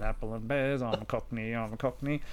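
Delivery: a quiet, lightly done fake Cockney; half-hearted Cockney accent, low and soft; mock Cockney. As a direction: mumble it like a put-on Cockney in a shop. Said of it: "and I was saying".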